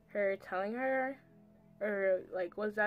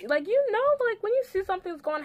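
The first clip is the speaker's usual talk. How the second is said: much higher, louder, insistent; emphatic; 11 semitones higher, 6 dB louder than usual. Real, not acted. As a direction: push the point hard. Emphatic argument to viewers.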